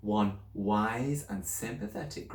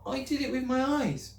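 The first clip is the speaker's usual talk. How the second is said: put-on higher, anxious little girl's voice; girl pleading with her teacher, higher and anxious; young Matilda. A narrator's reading. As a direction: speak up as a worried schoolgirl.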